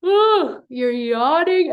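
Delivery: high ooh then slow yawning words. Shown as a demonstration. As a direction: open the back like a yawn. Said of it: "literally imagine".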